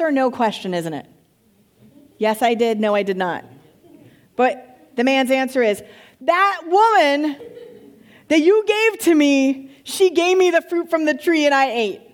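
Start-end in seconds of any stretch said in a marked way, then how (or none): 6.2-12.0 the man whining to God that she did it; whiny excuse. Acted. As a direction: pass the blame with a mocking whine. Man excuses himself, blaming woman.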